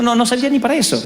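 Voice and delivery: ordinary talk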